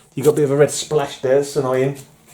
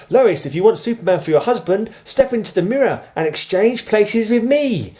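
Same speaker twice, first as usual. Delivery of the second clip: high, theatrical put-on voice; dramatic higher-pitched offer to Lois; comic-book temptress; 9 semitones higher than usual. Reads cover character tempting Lois.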